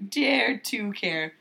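slowly drawn-out slogan; slow mock slogan. Shown as a demonstration. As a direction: stretch "Dare to care" out slowly, mock-earnest.

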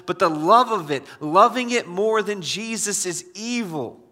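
full-force preaching. Acted. Preacher urging the church not to love money, loud and driving. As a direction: hammer each command home to the congregation.

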